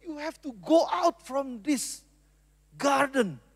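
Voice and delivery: soft, slow, mildly sorrowful plea; tearful, acted. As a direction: send them out gently, as if holding back tears. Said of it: "Dia sambil nangis". (pura-pura sedih, seperti sambil menangis)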